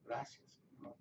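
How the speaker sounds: breathy whisper, barely voiced; whispered thanks. Real, not acted. Pastor whispering thanks to the Lord to himself. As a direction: whisper a thank-you to God under your breath.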